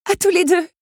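high, loud grateful cry; shouted thanks. Acted. Belle thanks her helpers as she flees, shouting. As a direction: call your thanks out at full voice.

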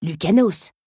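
loud, lofty correction; self-important, voice lifted; haughty. A narrator's reading. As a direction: correct them with wounded dignity. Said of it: "la corrigea l'intéressée d'une voix hautaine".

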